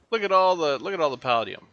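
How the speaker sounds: loud, high burst of excitement, easing off; excited marvelling. Real, not acted. Player spots all the palladium, excited. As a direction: marvel loudly at a rich find.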